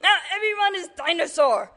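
high, loud melodramatic yell; horrified outcry. Acted. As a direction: cry it out in mock horror. Doctor's horrified outcry in story.

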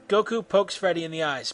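ordinary delivery, nothing marked

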